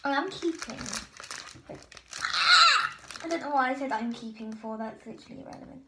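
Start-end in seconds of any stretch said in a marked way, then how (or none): 1.7-3.0 high sharp squeal; real squeal; excited squeal. Real, not acted. A sudden excited cry. 4.8-5.8 quick, softer whispered aside; real self-mocking mutter. Real, not acted.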